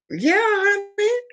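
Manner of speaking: high, drawn-out honey; sassy sing-song. Real, not acted. Vlogger teasing viewers, high sassy sing-song. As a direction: stretch honey up playfully. Playful sign-off phrase.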